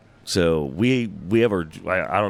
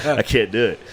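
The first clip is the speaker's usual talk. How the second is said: helpless laugh through the words, higher and louder; 5 semitones higher, 4 dB louder than usual. Real, not acted. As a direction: say it while cracking up, raised pitch. Laughs at own failed attempt.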